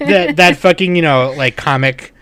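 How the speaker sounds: normal talking voice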